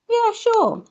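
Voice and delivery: put-on high child agreeing; child's voice. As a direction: answer like a happy little kid. Child answers in scene.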